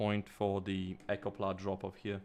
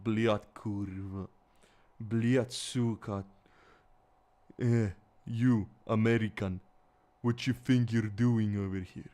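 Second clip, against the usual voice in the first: put-on Eastern European accent; 4 dB louder than usual. Showing how they hope they don't sound, loud mock Eastern European accent. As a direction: exaggerate an Eastern European accent, swearing forcefully. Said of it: "I hope I don't sound like that".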